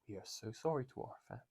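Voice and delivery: a soft, mock-apologetic Toto statement; understated Toto Wolff apology; Toto Wolff impression. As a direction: say sorry like a contrite team boss. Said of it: "That's my best Toto impression".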